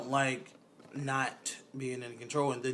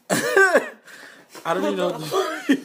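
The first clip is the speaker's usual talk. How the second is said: slow, high laughing outburst, much louder; 12 semitones higher, 9 dB louder, slower than usual. Real, not acted. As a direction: crack up while saying it. Laughing at friend's loud eating.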